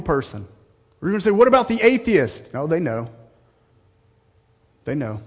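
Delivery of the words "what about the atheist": raised objection. Acted.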